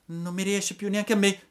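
high-pitched wailing, acted tears; plaintive puppet boy in distress; tearful cry. A narrator's reading. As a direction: cry out as if weeping and tottering. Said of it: "gridò Pinocchio piangendo e traballando".

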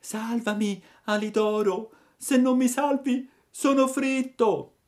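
tiny, very high pleading voice; faint little voice. A narrator's reading. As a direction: squeak out a plea for rescue. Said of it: "una vocina fioca fioca". (vocina fioca e supplichevole)

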